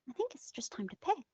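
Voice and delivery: sinister whisper, quick. Pretend. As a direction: hiss a quiet threat. Villainous ultimatum, whispered.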